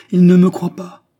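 very loud, high cry of exasperation; desperate outcry. A narrator's reading. Queen despairing that he doubts her, loud anguished cry. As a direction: cry it out in despair at being disbelieved.

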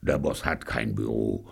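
low, soft deadpan; quiet low-pitched objection; low henchman voice. A narrator's reading. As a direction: drop the voice for a henchman's correction. Alf's dull correction.

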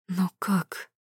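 quiet, husky shocked voice; hoarse, faltering. A narrator's reading. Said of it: "севшим голосом произносит Тамайна".

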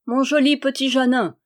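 put-on gloating shout at the condemned boy; mocking cry. A narrator's reading. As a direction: jeer at the boy as he passes. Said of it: "cria-t-il".

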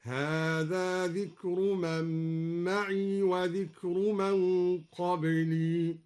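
معي with its ya made silent, slow chant; sukun on ma'i. Shown as a demonstration. Reciter demonstrating silent ya in معي. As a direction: say معي without a vowel on ya. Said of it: "أسكن الياء".

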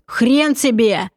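slow, rasping refusal; hoarse defiance. Acted. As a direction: spit it out hoarsely. Kobalt defiant after hoarse cry.

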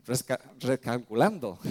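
a light imitation of a robotic GPS saying "recalculando"; mock sat-nav announcement, half-hearted; preacher lightly mimicking a GPS for the congregation. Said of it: "¿qué dice?".

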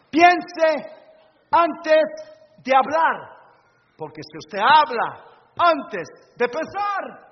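loud, slow, punched out from the pulpit; forceful preacher's command; emphatic warning. Real, not acted. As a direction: hammer each word home as a warning. Preacher hammers home a warning.

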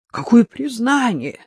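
high, startled outcry; scared yelp; frightened cry. A narrator's reading. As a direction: blurt it out in fright. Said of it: "испуганно вскрикнул Евгений".

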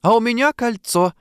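high, ringing announcement; high clear voice. A narrator's reading. Diana calls out above the table noise, high and clear. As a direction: ring out over the noise. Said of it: "высокий чистый голос Дианы".

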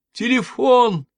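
calling after her. A narrator's reading. Patient calling after the nanny, loud and high. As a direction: call out after the departing nanny. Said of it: "Крикнула вслед Эвридика".

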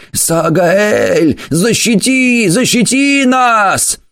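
high, desperate cry for protection; frightened plea. Acted. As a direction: beg the demon to save them. Terrified worshippers cry for protection. (испуганная мольба)

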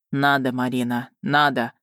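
low, reluctant insistence; sighing. A narrator's reading. Dragon tells Marina she must learn, sighing. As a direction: insist with a tired sigh. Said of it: "вздохнул Варган".

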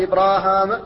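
"إبراهام" with two long aa vowels, Ibraahaama; both alifs kept. Shown as a demonstration. Teacher listing variants, "إبراهام" with both alifs held long. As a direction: draw out both the raa and the haa in "إبراهام". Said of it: "فإما أن يثبت الألفان فيقال".

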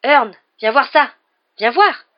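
loud, high, slow-stretched call; excited calling out. A narrator's reading. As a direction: call your mate over, amazed. Stan calls his mate over, amazed.

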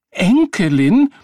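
high, loud, stretched disbelief; outraged gasp. A narrator's reading. Woman taken for a grandmother, gasping in outrage. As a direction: echo the word, aghast and shrieking upward. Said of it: "keuchte sie".

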